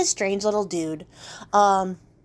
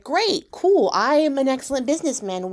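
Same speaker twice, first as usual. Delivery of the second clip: cocky excitement; 5 semitones higher than usual. Acted. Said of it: "And Jack's like".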